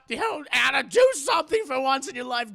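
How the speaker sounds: loud, high scolding shout; scolding yell. Retold or imitated. Mother yelling at Anna to finally do something. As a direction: yell it at the lazy daughter.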